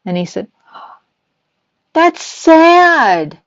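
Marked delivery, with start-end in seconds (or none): 1.9-3.4 put-on child's sad voice. Grandson voiced in retold story.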